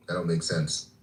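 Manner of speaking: usual speaking voice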